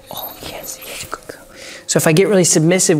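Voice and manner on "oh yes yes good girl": gentle whispered praise to the dog. Real, not acted. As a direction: breathe the praise, barely voiced.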